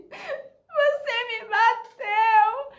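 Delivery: high, drawn-out mock wail; telenovela fake crying. Pupil falsely accusing the teacher, melodramatic fake crying. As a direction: wail it slowly like a hammed-up soap victim. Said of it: "a minha novela mexicana".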